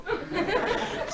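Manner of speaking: high-pitched burst of laughter; quick squeaky giggle. Real, not acted. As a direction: laugh at your own joke. Laughing at own impression.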